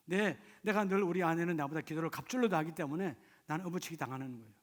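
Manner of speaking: ordinary talk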